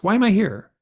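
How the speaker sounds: exasperated shouted question; frustrated shout. Retold or imitated. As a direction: cry out why you are here. Retold outburst at beings.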